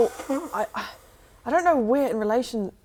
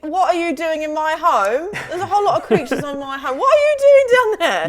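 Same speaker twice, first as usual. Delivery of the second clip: high, loud shout building to a yell; indignant shout; 9 semitones higher, 9 dB louder than usual. Acted. Buble yells at creatures on the old home, indignant shouting. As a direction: holler down at intruders, getting louder and higher. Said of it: "as you're shouting this".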